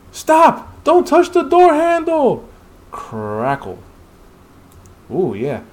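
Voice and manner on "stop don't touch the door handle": much higher, louder warning yell; urgent shout. Acted. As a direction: scream at him to stop. Warning another hunter.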